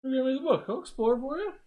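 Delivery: squeaky, high-pitched teasing; high excited voice. Real, not acted. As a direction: jump way up in pitch.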